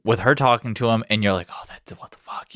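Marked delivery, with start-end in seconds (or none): 1.5-2.5 breathless whispered disbelief; shocked whisper. Retold or imitated. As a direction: whisper it as if watching in horror. Whispered audience reaction.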